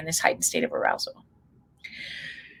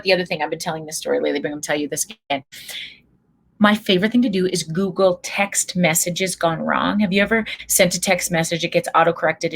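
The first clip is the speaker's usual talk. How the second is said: usual speaking voice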